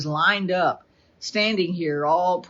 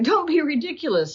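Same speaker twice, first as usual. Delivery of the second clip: Don't be ridiculous pitched higher, dismissive; scoffing king; 8 semitones higher than usual. Retold or imitated. King Saul brushing off David, mildly scornful. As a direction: wave the boy off with a sneer. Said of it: "Saul said".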